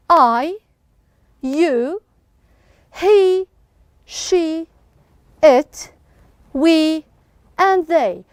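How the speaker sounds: one word at a time, evenly spaced; deliberate, measured classroom recitation; read-along word list. Shown as a demonstration. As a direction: leave a gap after each word for the class to repeat. Teacher leads class reading word list.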